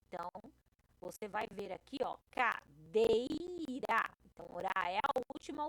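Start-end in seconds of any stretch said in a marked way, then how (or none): 2.3-4.1 "cadeira" drawn out, with "dei" long and strong; stressed middle syllable. Shown as a demonstration. Teacher shows a paroxítona, slow "cadeira" stressed on "dei". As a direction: stretch and punch "dei" in "cadeira". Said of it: "o dei é a mais forte".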